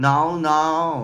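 "น้อๆ" stretched long and slow; drawn-out pronunciation demo. Shown as a demonstration. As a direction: say "น้อๆ" with slow, held vowels. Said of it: "มันต้องแบบ".

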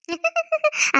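short high-pitched laugh; brief laugh at being praised; pleased laugh. Acted. Pleased by praise of her cooking.

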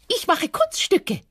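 very high chirpy rabbit voice; proud little rabbit. Acted. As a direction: show off like a child. Hase antwortet dem Fuchs. (hohe, stolze Hasenstimme)

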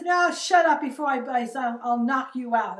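loud, high, threatening snap; retold threat. Angry man threatening her from the car, loud retold snap.

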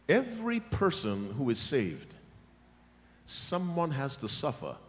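normal talking voice

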